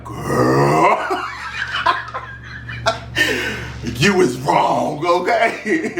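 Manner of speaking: high, slow teasing scold with laughter; laughing scold. Retold or imitated. Reacting to Kyle's text, laughing slow high scold. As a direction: scold her while cracking up. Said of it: "I'm like".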